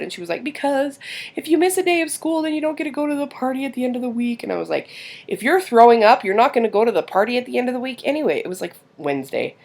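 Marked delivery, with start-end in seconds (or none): 0.4-4.4 put-on high-pitched child explaining herself; imitated daughter. Daughter explains to mom why she hid it, high voice. 5.3-8.4 higher, quick, emphatic reply; retold mom retort. Mom sets sick daughter straight about party, emphatic.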